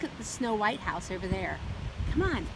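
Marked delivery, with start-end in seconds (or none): none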